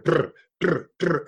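short buzzing pull-start noises; imitated saw sputter. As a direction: mimic a saw failing to start. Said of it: "these guys are over there".

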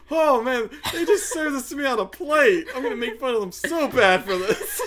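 high, loud voice cracking up with laughter; laughing glee. Retold or imitated. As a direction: gloat through laughter as a mocking heckler. Laughing while voicing gleeful Spinner.

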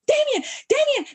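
high desperate repeated shout; frantic yell. Retold or imitated. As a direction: call out his name in panic. Frantic mother reviving son.

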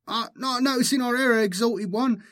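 very high, fast, fawning gabble; babbling herald. A narrator's reading. Herald to the exalted one he has displeased, high babbling. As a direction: babble apologies in a high-pitched rush. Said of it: "babbled the herald".

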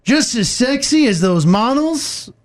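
slow, dramatic poetry reading; poetic reading. Acted. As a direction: recite it like a fireside poem. Reads lyrics as poetry.

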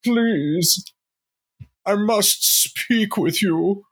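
high, drawn-out suitor's plea; hammed-up high romantic lord voice; Lord Radriel. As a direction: play an amorous apparition begging an audience. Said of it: "and being like".

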